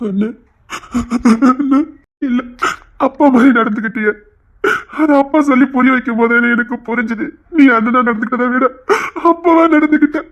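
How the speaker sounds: sobbing gratitude to a brother; weeping. Acted. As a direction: choke out loving thanks between sobs. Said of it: "இப்படி அழுதுட்டு இருக்க".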